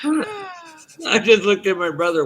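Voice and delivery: real laughter, slow and broken, mid-line; cracking up while talking; laughing. Real, not acted. Telling about his brother outsmarting the sheriff, laughing hard.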